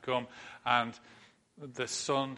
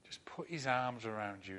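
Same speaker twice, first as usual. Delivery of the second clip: normal talking voice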